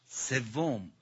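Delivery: usual speaking voice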